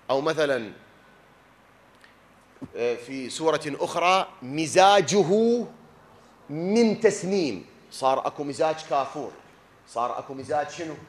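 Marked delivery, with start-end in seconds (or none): 4.4-7.6 verse recital. Shown as a demonstration.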